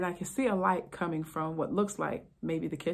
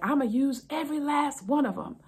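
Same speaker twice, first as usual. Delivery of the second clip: higher, loud defiant yell; husband shaking a pill bottle, yelling at the officer; 8 semitones higher, 4 dB louder than usual. Retold or imitated. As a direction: shout it out stubbornly. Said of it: "stop yelling".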